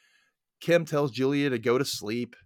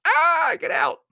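high, loud little scream; mock panic; 4 dB louder, slower than usual. Viewer warning Kim to flee, mock panic. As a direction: yelp a warning at the screen.

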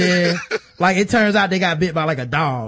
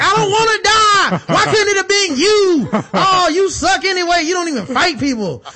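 whiny coward; 6 dB louder than usual. Retold or imitated. Dying man whines at his friend, high and loud. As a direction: whine at a teammate, much higher and louder than usual. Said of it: "I'm gonna be like".

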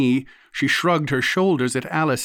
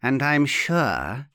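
slow, silky politeness; particularly suave; slower than usual. A narrator's reading. Aunt adding to her mockery, particularly suave. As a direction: add it suavely, taking your time. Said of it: "she added in a particularly suave voice".

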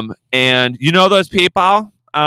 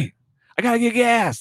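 high pitched call over distance; replaying the yell, a mild mock call; retold call; 11 semitones higher, 4 dB quieter than usual. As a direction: call out to be heard far off. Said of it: "No, I said".

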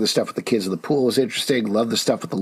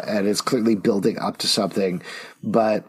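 ordinary talk, nothing marked

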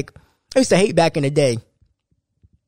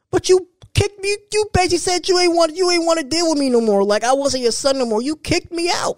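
exasperated rant; 11 semitones higher than usual. Real, not acted. Ranting at mom about eviction.

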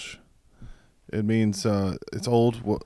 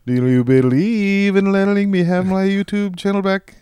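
higher, louder robot through a vocoder; 9 semitones higher, 7 dB louder than usual. Pretend. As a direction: sound like a talking machine. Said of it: "apologize with a vocoder on".

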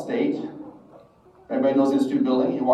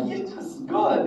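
gleeful relief. Retold or imitated. Retold relief at being too late.

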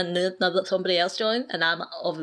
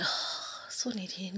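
breathy whisper under the breath, much softer; whispered aside; 12 dB quieter than usual. Retold or imitated. Whispering a gripe about a needy clanmate. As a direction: whisper the gripe so he can't hear.

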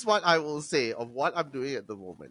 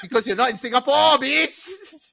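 mock retort; 9 semitones higher, 6 dB louder than usual. Retorting at imagined customer.

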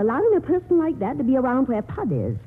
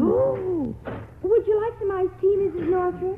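sudden sugary politeness; 9 semitones higher than usual. Acted. Aunt turns sweet after money.

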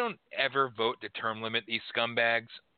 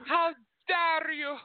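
high, slow, outraged squeal; Greta Thunberg impression; slower than usual. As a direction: shriek it like the meme girl. Repeats meme line again.

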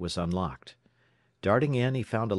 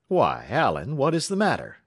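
higher, louder surprised question; swooping, taken-aback inquiry; startled concern; 6 semitones higher, 5 dB louder than usual. A narrator's reading. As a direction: ask in sudden alarm. Superintendent notices the chief's distress.

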